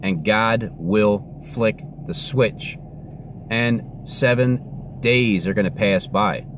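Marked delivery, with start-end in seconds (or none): 3.5-6.4 slow, deliberate prediction; slow, measured. Real, not acted. Telling everybody what God will do, slow and measured. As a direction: spell it out slowly, word by word. First take of repeated prediction.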